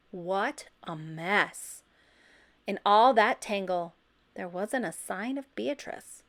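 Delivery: ordinary delivery, nothing marked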